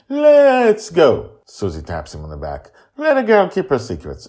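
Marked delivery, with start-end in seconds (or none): 0.1-1.4 high, loud firm grandmother; high brisk grandmotherly voice; grandma voice. Acted. Susie hurries Justin out. 3.0-4.3 high, loud knowing grandmother; high chiding grandmotherly voice; grandma voice. Acted. Susie scolds Justin.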